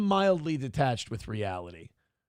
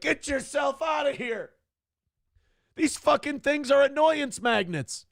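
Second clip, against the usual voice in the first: mock shout; 9 semitones higher than usual. Cops shouting in retold scene.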